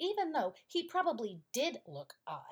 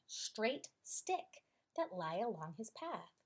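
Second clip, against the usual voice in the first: ordinary delivery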